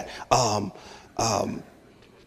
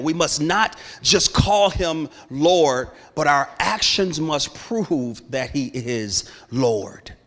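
slow emphatic; slower than usual. Shown as a demonstration. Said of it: "slow emphatic statement".

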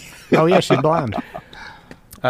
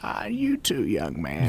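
put-on old woman voice; 2 semitones higher, 3 dB quieter, slower than usual. GM voices Opoly.

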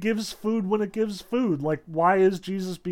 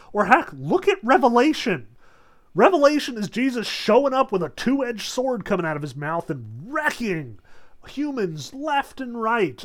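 higher and louder, building excitement; excited; 5 semitones higher, 4 dB louder than usual. Real, not acted. Lecturer relishing Revelation's wrath, higher and louder. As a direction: ramp up with relish at the gore.

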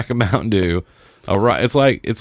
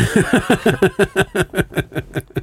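high cackling laugh; 11 semitones higher than usual. Real, not acted. Laughing at cheating excuse.

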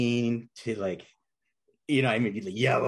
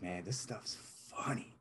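whisper; 11 dB quieter, slower than usual. Retold or imitated. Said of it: "I'm just like".

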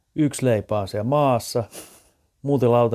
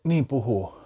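ordinary talk, nothing marked

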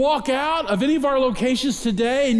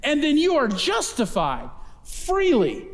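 higher, ringing sermon build on each line; preaching at full force; 5 semitones higher than usual. Acted. Pastor rising to the congregation about freedom, preaching full force.

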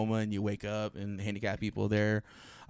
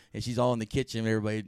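ordinary talk, nothing marked

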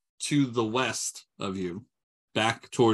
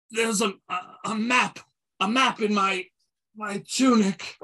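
high, faltering, fading-out voice; losing consciousness; 8 semitones higher than usual. Acted. Captive reveals his map to the party while losing consciousness. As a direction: stumble over the words as if passing out. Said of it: "losing consciousness".

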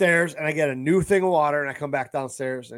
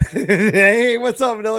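excited dog greeting; 8 semitones higher, 8 dB louder, slower than usual. Retold or imitated. Greeting dog in retold scene.

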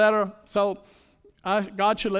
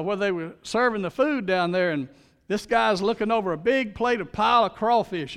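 ordinary talk

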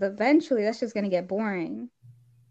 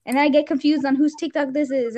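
ordinary talk, nothing marked

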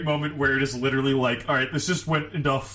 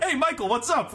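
mock casual greeting; 11 semitones higher than usual. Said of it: "you're like".

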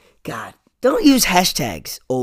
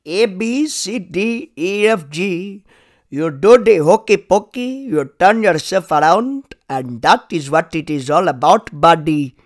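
sings the alphabet in a put-on Indian accent; mock Indian accent, sing-song and loud; 2 semitones higher, 5 dB louder than usual. Host jokes that children's songs lack accents, put-on Indian accent. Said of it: "where are the Indian voices".

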